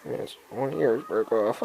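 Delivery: normal talking voice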